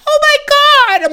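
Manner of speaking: very high, loud, drawn-out gasp of horror; shocked mock shriek. Voicing the ex seeing the picture, shocked shriek. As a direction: imitate an appalled ex, slow and high. Voices ex's shocked reaction.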